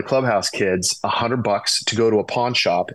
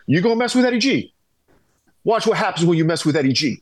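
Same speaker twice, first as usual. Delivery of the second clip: much higher and fast, a playful gangster act; hammed-up mock threat; 7 semitones higher, faster than usual. Prankster teammate taunting the rookie over his guitar, hammed-up menace. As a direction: puff up like a cartoon tough guy ready to swing.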